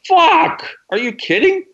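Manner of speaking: retold high-pitched shock, thrown up high; stunned disbelief. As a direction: squeak out the shocked realization. Retold shocked outburst to Gary.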